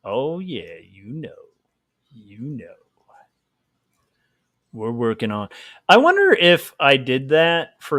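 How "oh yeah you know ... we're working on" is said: creepy voice. Shown as a demonstration. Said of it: "that's my creepy voice".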